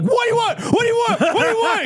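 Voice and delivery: high, frantic imitation of a screaming sandwich-shop guy; mock high-pitched yelling at a customer; screamed demand. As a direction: scream it like the Soup Nazi, rapid-fire. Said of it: "people are screaming".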